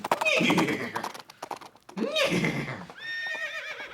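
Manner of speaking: a long high burst of laughter; laughing. Real, not acted. He laughs at his own horse relay joke, cracking up.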